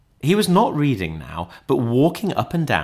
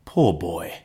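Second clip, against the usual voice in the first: lower, subdued pity; 4 semitones lower than usual. A narrator's reading. Scrooge pities his lonely former self, sorrowful. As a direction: let the excitement drop into sorrow. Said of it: "in pity for his former self".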